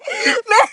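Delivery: squeaky laughing sob; real tearful giggle; high laugh-cry. Real, not acted. Laugh-cry after scene.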